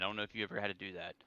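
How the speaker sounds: normal talking voice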